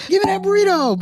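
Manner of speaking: high-pitched, exaggerated cheer; mock happy. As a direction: imitate someone being all happy at work. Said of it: "being all happy and stuff".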